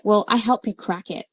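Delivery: ordinary talk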